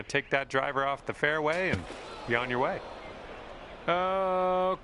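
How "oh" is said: dismayed oh. Real, not acted. Bad shot reaction.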